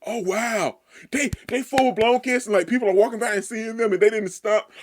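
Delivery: high, loud, breathless astonishment; excited disbelief. Real, not acted. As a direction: gush in disbelief. Shocked by the public kiss.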